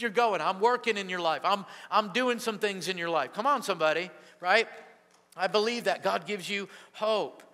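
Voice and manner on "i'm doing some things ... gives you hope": ordinary delivery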